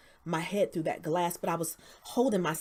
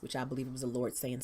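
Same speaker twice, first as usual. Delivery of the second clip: ordinary talk, nothing marked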